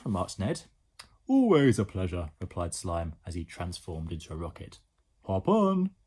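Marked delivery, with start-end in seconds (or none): 1.3-2.3 loud, put-on creature voice; big cartoon slime voice; slime character. A narrator's reading. Slime character replies in story. 5.2-5.9 high, loud put-on voice; big cartoon invitation; slime character. A narrator's reading. Slime invites boy aboard.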